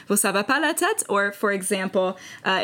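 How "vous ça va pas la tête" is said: playful disbelief. Retold or imitated. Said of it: "you respond".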